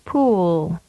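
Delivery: "Pool." with the oo held long; drawn-out "Pool."; long vowel. Shown as a demonstration. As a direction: stretch the oo of "Pool.". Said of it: "and then with a longer duration".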